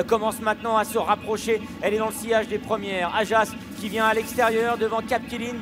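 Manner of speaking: ordinary delivery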